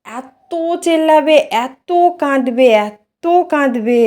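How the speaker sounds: slow, high, stretched-out words; drawn-out emphasis. Real, not acted. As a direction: stretch each word to stress how much. Stressing how much he will cry. (টেনে টেনে জোর দিয়ে বলা)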